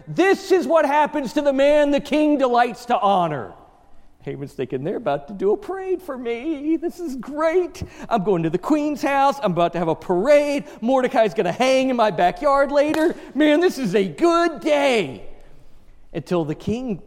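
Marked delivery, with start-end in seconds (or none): 0.1-3.5 high, loud herald cry; herald's proclamation. Retold or imitated. Haman imagining a herald crying out before the horse, high and loud. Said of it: "trumpeting out there". 4.8-15.2 high, giddy and gleeful; gloating glee. Retold or imitated. Haman gloating over a parade and a hanging, giddy and high.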